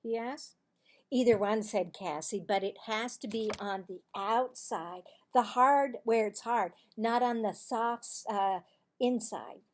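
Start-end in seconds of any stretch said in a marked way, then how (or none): 1.1-1.6 loud, bright schoolgirl telling a secret; Cassie's voice. Acted. Girl telling Marvin a playground rumour, loud and eager. 2.4-9.6 loud, bright schoolgirl telling a secret; Cassie's voice. Acted. Girl telling Marvin a playground rumour, loud and eager.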